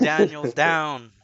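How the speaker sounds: slow loud mock announcement. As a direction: draw it out like a dramatic announcer. Mock announcing friend's choking.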